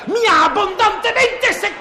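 furious outburst. Acted. Director exploding at the cameraman, furious. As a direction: let the anger burst out at last.

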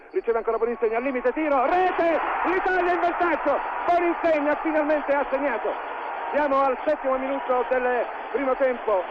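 rising excited goal call; goal commentary. Acted. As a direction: erupt as the ball hits the net.

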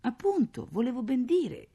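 high-pitched, awkward excuse; flustered stammer. A narrator's reading. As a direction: try to explain under attack. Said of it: "balbettava papà". (balbettio imbarazzato e acuto)

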